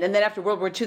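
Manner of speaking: normal talking voice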